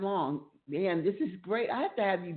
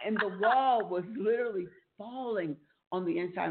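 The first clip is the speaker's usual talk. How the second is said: normal talking voice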